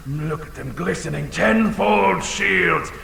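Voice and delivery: usual speaking voice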